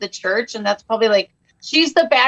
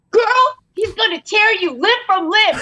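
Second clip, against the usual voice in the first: high, urgent mock panic; frantic friend telling her to run, pitched up high; Bone's panicked warning; 8 semitones higher than usual. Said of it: "He goes".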